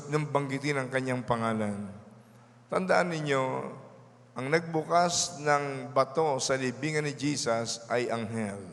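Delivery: usual speaking voice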